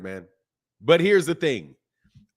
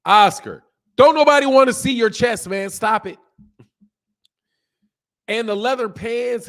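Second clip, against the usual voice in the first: higher, louder mock scolding of Oscar; loud call-out; 7 semitones higher, 8 dB louder than usual. Real, not acted. Scolding Oscar about his bare-chest outfit, loud call-out. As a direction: yell at him to cut it out.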